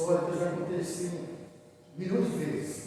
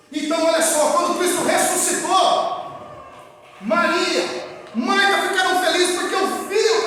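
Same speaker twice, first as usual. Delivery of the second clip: loud, high, exultant sermon; jubilant full-force preaching; 13 semitones higher, 9 dB louder than usual. Real, not acted. Preacher telling of Martha and Mary rejoicing at the resurrection, full-force preaching. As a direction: proclaim the miracle at full voice.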